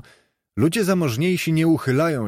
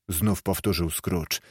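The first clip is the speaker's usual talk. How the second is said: ordinary delivery, nothing marked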